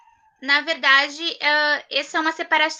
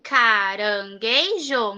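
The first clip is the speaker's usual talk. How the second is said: slow repeat; 5 semitones lower, 4 dB quieter, much slower than usual. Shown as a demonstration. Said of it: "teacher repeats word slowly".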